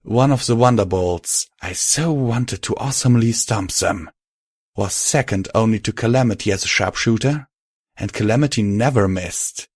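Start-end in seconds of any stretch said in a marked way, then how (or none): none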